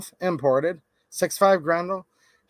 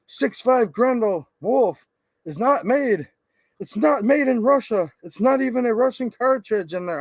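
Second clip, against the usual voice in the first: higher-pitched imitation of an outraged commenter; mock yelling; 6 semitones higher, 3 dB louder than usual. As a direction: mimic someone losing composure, hammered words. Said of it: "screaming and yelling at me".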